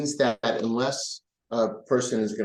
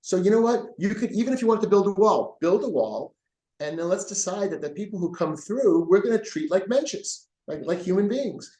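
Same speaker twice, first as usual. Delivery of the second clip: higher and brighter, a lightly acted eager shared voice; eager agreement; 9 semitones higher than usual. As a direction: act out two men finding agreement with a lift. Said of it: "And we both said".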